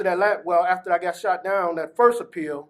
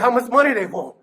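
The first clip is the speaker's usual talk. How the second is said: laughing; 3 semitones higher, 5 dB louder than usual. Retold or imitated. Asks sister about attorney fee.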